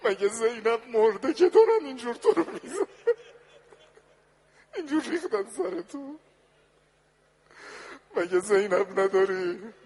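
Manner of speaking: very high, loud keening cry; anguished lament. Acted. Daughter crying over her father at the slaughter-place, loud keening. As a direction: wail it over the fallen body. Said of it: "یه روز دخترش اومد تو قتلگاه".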